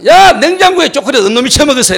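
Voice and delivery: high, loud outraged demand; angry yell. Retold or imitated. Pastor discovers chocolate missing, furious high yell. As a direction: holler to find the culprit. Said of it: "내가 소리 지르고 막 그러다가".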